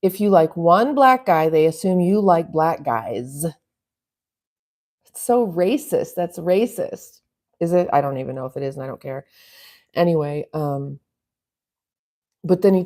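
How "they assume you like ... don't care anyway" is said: ordinary talk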